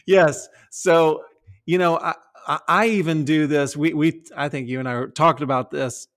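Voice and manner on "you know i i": normal talking voice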